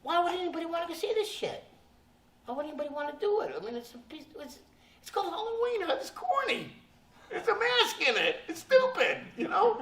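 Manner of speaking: high, fast, mocking rant; a hint of sneering outrage; scornful disbelief. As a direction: scoff at the stupid movie. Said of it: "I said, I thought".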